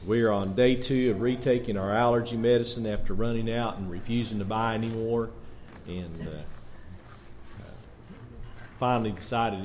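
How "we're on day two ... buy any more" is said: ordinary talk, nothing marked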